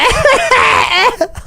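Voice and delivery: high, loud real laugh; real amused laughter; burst of laughter. Real, not acted. Laughing at the clip's caption.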